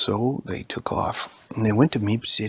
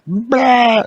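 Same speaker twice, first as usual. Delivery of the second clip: much higher and louder blah; big jump scare blah; 13 semitones higher, 12 dB louder than usual. Acted. The Siege shows how to scare, loud blah. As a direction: burst out to scare. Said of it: "And he went".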